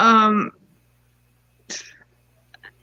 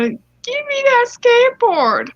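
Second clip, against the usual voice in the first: high, drawn-out demand; hammed-up bossy mother voice; entitled mom; 12 semitones higher, 3 dB louder, slower than usual. Entitled mom demands a stranger's skateboard, high hammed-up whine. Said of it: "here comes the voice actors".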